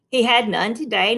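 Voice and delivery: usual speaking voice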